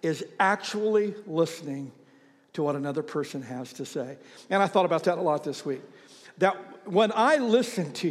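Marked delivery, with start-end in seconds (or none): 0.0-4.2 slow, deliberate reading; slow quote. Shown as a demonstration. Pastor reading Brian McGill's quote to the church, slowly. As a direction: let each word land. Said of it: "He says".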